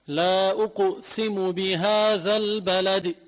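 slow chanted recitation, the qaf of أقسم bouncing with a slight echo vowel; qalqala on أقسم. Shown as a demonstration. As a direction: recite بهذا البلد slowly with a clear qalqala on أقسم. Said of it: "هذه اسمها القلقلة".

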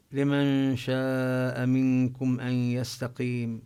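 chanted Quran verse, drawn out; Quran recitation. Acted. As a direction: recite the verse slowly and carefully.

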